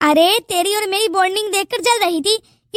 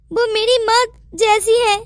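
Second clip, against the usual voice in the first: normal talking voice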